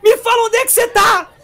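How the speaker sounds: high loud frantic shouting mother; mother shouting at her son on the phone; desperate mom. Retold or imitated. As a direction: yell like a panicked mother on the phone. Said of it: "desesperada, falando assim".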